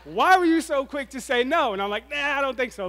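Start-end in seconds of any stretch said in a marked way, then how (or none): 0.0-1.7 high, loud teasing challenge; playful divine needling, pitched up and loud; God prodding. Retold or imitated. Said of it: "God was like". 2.1-2.9 high-pitched comic "Nah"; mock-reluctant brush-off, pitched up; dodging refusal. Speaker brushes off God's prodding.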